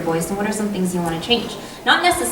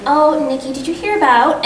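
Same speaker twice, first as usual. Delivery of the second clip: raised, eager gossip opener; chatty to a friend; 7 semitones higher, 4 dB louder than usual. Shown as a demonstration. Actor greeting best friend Nikki before a read, eager and chatty. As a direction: start as if telling your best friend news. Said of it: "imagine that I'm talking to my best friend".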